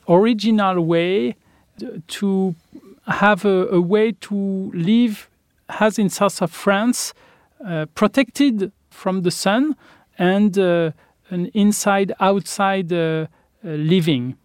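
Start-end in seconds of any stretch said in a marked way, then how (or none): none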